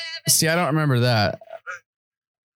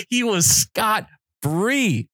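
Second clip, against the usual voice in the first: ordinary delivery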